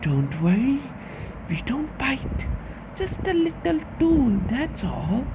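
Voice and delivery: high, soft and eerie, like something lurking in the dark; creepy creature voice. Acted. A lurking creature invites the player in, high and eerie. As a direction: coax the visitor in with a sinister, hushed sweetness.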